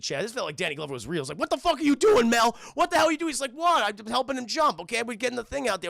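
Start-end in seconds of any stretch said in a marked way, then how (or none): 1.4-3.3 high, loud acted outrage; losing it. Furious cop to his partner after the jump, high mock yell. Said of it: "he just loses it". 3.5-5.9 higher, loud start, indignant; mock defensive protest. Reckless cop defending himself to his partner, higher-pitched protest.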